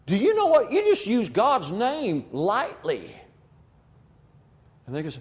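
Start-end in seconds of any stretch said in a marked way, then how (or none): 0.0-3.1 hammed-up shocked gasp, voice jumping high; mock-horrified, high-pitched reproach; acting shocked. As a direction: play scandalized at what you just heard. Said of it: "just act shocked and say".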